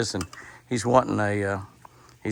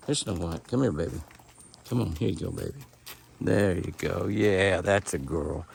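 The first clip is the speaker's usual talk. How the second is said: softer, gentle coaxing murmur; coaxing pet talk; 3 semitones lower, 7 dB quieter than usual. Real, not acted. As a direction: coax her closer, gently. Coaxing deer to eat carrots.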